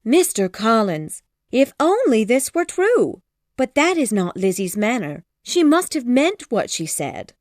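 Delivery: flustered mother exclaiming; alarmed Mrs Bennet. A narrator's reading. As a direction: hurry to set him straight.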